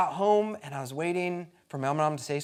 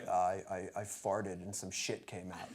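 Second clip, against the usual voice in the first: 1 nervous slob; 11 semitones lower, 13 dB quieter than usual. Retold or imitated. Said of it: "he's like".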